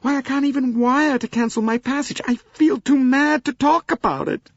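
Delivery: high, loud American wail; wailing Mrs Hubbard. Acted. Mrs Hubbard wails to the passengers about being stranded. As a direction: lament loudly like a fussy American matron. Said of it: "loudest in her lamentations".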